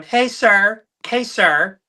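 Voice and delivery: high, loud put-on woman's call; lady's voice. Dad pranking the drive-through in a lady's voice. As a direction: holler it like a lady somewhere unseen. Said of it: "he does this lady's voice".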